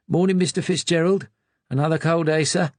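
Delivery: chirpy doorman saying good morning; cheery porter. A narrator's reading. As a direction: greet him like a friendly desk man. Desk porter greets David.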